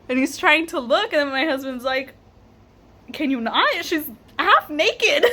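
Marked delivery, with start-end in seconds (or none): 3.1-5.2 protective husband imitated, rising and drawn out; retold indignation. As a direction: scold the gawker in mock outrage.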